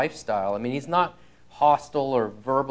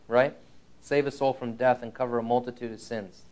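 ordinary delivery

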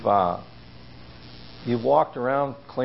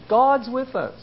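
emphatic declaration; 7 semitones higher than usual. Real, not acted. Preacher stating faith's certainty.

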